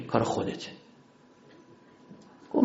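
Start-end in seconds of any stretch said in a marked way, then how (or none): 0.1-0.7 barely whispered, soft and low; hushed answer. Retold or imitated. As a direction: let the painful truth out in a whisper. Ali's painful answer to Uthman.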